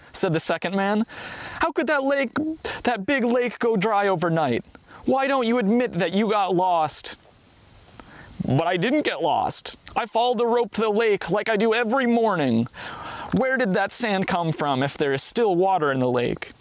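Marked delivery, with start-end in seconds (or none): 1.6-7.2 high-pitched, disbelieving argument; storyteller voicing a scornful skeptic; incredulous challenge. A narrator's reading. Disbelieving man argues back. 8.5-16.5 high-pitched self-defence; storyteller voicing a flustered denial; insistent protest. A narrator's reading. Accused man protests in quarrel.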